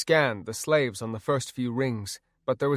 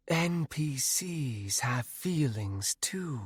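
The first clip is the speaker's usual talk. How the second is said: slow, softer ghostly rebuke; rebuking wraith voice; 3 semitones higher, 5 dB quieter, slower than usual. A narrator's reading. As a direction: scold gently from the shadows. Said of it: "Nanaya rebuked".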